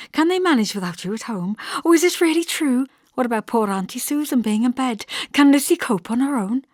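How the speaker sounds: put-on high, excited young woman; bubbling over with disbelief and joy; eager Hester. A narrator's reading.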